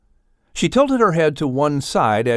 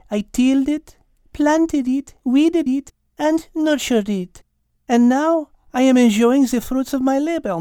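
French cat pleading; 10 semitones higher than usual. Acted. Cat defending her garden to Michael, French-accented plea.

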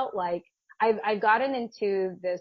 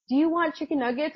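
talking to dog; 7 semitones higher than usual. Retold or imitated. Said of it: "talking directly to my dog".

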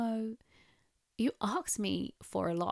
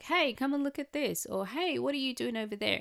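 higher, upbeat call to distract; redirecting; 7 semitones higher than usual. Retold or imitated. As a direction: lure a child's attention elsewhere. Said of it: "another thing I learned at the end was redirecting".

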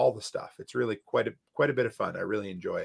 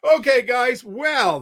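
loud, high holler; 12 semitones higher, 8 dB louder, slower than usual. Real, not acted. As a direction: holler it into the mic. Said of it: "I think I just hollered into my mic".

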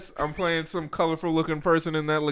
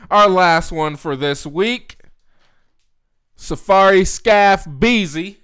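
hype announcement; 5 semitones higher, 8 dB louder, slower than usual. Acted. Hype introduction of the guest.

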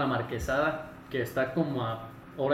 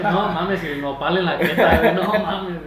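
laughing; 4 semitones higher, 10 dB louder than usual. Real, not acted. Laughing while teasing old memories.

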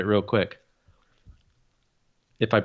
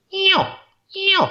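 smooth high call sound; 4 dB louder than usual. Shown as a demonstration. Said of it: "it should be a smooth".

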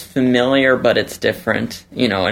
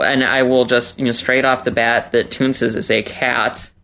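ordinary talk

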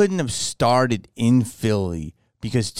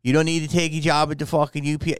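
put-on higher, indignant Philly accent; 4 semitones higher than usual. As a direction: scold like a Philly local. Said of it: "he's doing the best Philly accent".

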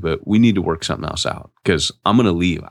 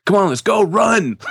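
higher, louder call to flee; urgent shout; 14 semitones higher, 4 dB louder than usual. Retold or imitated. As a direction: yell at him to run now. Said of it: "I go".

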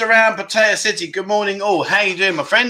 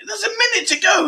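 high, urgent yelp of a warning; mock panicked shout. The host mimics his panicking co-host's countdown warning, high mock shout. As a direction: squeal it like a frantic mate counting down. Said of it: "He's messaging me, like".

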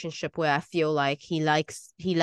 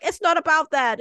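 indignant protest; 9 semitones higher, 5 dB louder than usual. Retold or imitated. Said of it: "I'm like".

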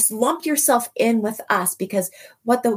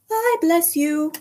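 excited blessing; 9 semitones higher, slower than usual. Real, not acted. Blessing listeners, high.

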